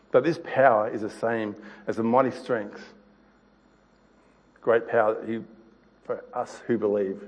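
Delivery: soft, measured reading of the Bible passage; scripture reading. Acted. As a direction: read it like a calm sermon text. Reads Ephesians aloud.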